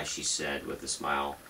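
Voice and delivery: ordinary delivery, nothing marked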